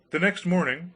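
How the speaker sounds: ordinary delivery, nothing marked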